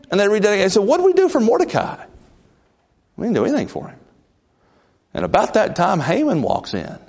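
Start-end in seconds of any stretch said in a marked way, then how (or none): 0.8-4.0 high, fast worried king; high urgent royal question. Retold or imitated. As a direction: demand it like a king caught out.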